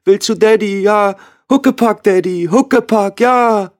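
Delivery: put-on a three-year-old reaching for her daddy; high, small toddler's call; toddler asking. A narrator's reading. Toddler begs father for piggyback.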